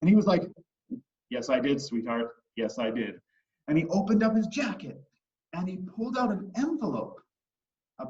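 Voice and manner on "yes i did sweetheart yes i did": low, measured dad voice; composed, deep-pitched dad; father's reply. Acted. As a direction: answer an excited child calmly. Dad answering his excited daughter.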